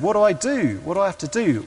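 mildly desperate retold plea; urgent pleading question. Acted anxious question from seeker.

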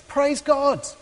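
high, drawn-out jubilant praise; mock ecstatic cry. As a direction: imitate an overjoyed convert without full volume. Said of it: "hears someone screaming behind the class building".

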